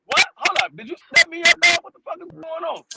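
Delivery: high, baffled retelling of disbelief; high-pitched mock bewilderment; bewildered protest. Retold alarm at recruiter's parting words.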